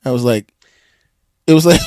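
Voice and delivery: ordinary talk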